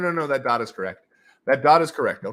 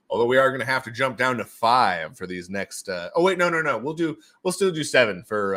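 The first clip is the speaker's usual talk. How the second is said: ordinary talk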